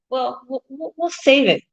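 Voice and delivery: normal talking voice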